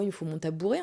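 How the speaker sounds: usual speaking voice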